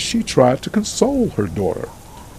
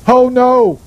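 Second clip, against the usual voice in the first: loud, high cry of dismay; narrator's raised-voice shout; 7 semitones higher, 5 dB louder than usual. A narrator's reading. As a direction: cry out in protest, pitched high. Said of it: "Maggie shouted before the doctor".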